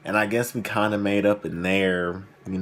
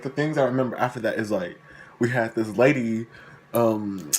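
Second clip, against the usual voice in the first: ordinary delivery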